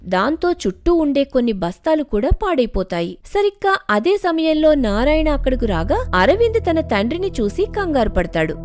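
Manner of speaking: usual speaking voice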